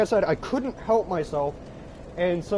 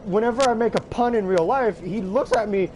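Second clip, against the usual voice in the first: usual speaking voice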